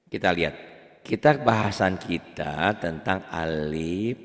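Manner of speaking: ordinary talk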